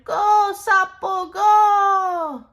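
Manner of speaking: high, loud and slow cheer; cheering shout. Acted. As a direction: cheer the racer on. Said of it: "voices shouting".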